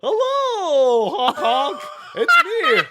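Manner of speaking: very high goofy clown voice; silly clown. Acted. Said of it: "Count Trivia has brought his friend".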